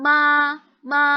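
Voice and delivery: "ma" held long and level; flat first tone. Shown as a demonstration. Tutor shows student Mandarin tone one, flat. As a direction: sustain "ma" without rise or fall.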